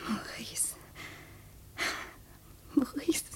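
leise, gehauchter Name; ergriffenes Flüstern. Acted. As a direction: hauche seinen Namen ergriffen. Wiedersehen mit dem Geliebten. (Maurice ergriffen geflüstert)

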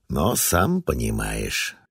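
slow, drawn-out words; drawled. Acted. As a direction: stretch each word out slowly. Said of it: "протянул он".

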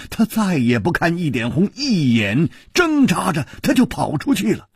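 ordinary delivery

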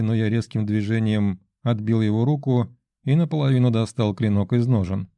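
usual speaking voice

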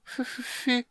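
high, breathless stammer, words stuck. Acted. Tongue-tied kender stammering before the old wizard. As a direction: choke on the first sound again and again. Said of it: "заикался Тасс".

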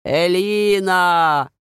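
loud, slow, drawn-out call. A narrator's reading. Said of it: "только ещё громче".

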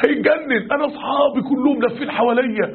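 retold excitement, high-pitched; thrilled, beside himself. Young man back from friends who now respect him, thrilled. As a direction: imitate an elated young man, voice lifted. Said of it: "الولد رجع لنا هيتجنن".

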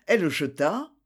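ordinary delivery, nothing marked

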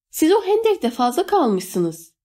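high, slow, contained shout. A narrator's reading. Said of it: "diye bağırdı Eno". (tiz ve ağır, hafifçe bağırarak)

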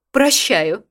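loud, lordly pardon; majestic. A narrator's reading. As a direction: grant it like a queen. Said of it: "величественно произнесла Неонила Альбертовна".

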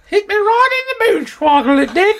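very high put-on British granny voice; old British lady. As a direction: parody the old lady's odd phrase. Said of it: "I guess that's what she said".

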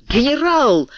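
high, startled cry; high-pitched astonished outburst; echoed exclamation. A narrator's reading. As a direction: echo the cry as amazed soldiers. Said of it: "повторили солдаты".

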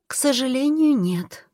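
high female voice, dropping on the last word; regretful goddess. A narrator's reading. Goddess telling the king no, gently regretful.

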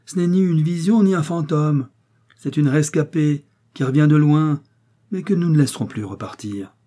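loud declaration. Acted. Father declaring his niece saved, loudly. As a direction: announce it to the room. Pharmacist calls out.

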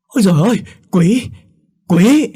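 high, loud shriek of horror; loud terrified scream. A narrator's reading. Woman wakes and sees the maid as a demon, screaming. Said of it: "thì lại hét lên thật lớn".

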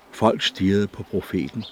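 usual speaking voice

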